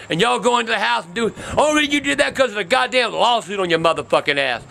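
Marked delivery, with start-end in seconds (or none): none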